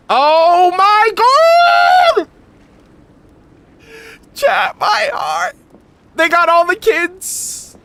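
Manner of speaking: high, loud, stretched-out squeal of joy; melting over something adorable, full voice; squealing with delight. Real, not acted. Streamer gushing to chat over the kids, delighted squeal.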